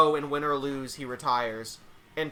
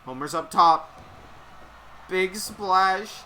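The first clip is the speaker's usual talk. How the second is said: lively ringside play-by-play; wrestling commentator; 5 semitones higher, 4 dB louder than usual. Acted. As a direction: call each move as it lands.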